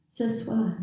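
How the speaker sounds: ordinary delivery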